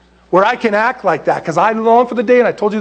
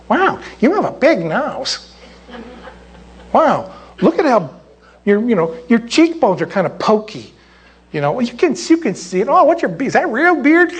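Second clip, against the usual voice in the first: lightly voiced child, higher pitch; curious little kid; 6 semitones higher than usual. Kid examining Jesus's face, playful childlike wonder. Kids climbing on Jesus, marvelling.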